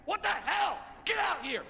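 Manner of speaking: high-pitched mock-angry yell; retold yelling. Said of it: "I start yelling at this guy".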